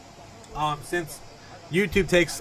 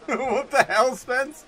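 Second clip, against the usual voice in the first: laughing; 9 semitones higher, 7 dB louder, much slower than usual. Real, not acted. Laughing reaction to chat.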